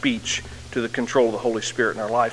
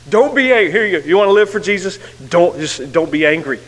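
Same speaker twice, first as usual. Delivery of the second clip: higher, louder mock command; mock scolding; 5 semitones higher, 5 dB louder than usual. Preacher mocking a simplistic rule, a mock scolding. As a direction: lampoon a preachy don't-be-angry order.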